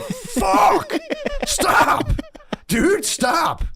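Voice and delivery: high, loud yell with a laugh; yelling at a dog that jerked the leash; angry yell. Retold or imitated. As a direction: shout at the dog mid-stumble, laughing. Dog yanks leash, sudden rage.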